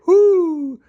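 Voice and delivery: a first high, loud try at an owl's whoo; owl hoot. Acted. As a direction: hoot whoo like a little bird playing owl. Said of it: "I sounded so owlish".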